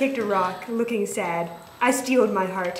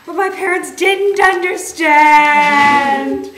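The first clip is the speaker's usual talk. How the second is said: loud, slow, sung-out protest; drawn-out dramatic cry; dramatic wail; 6 semitones higher, 11 dB louder, much slower than usual. Acted. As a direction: wail it like a melodrama. Dramatic outburst in story.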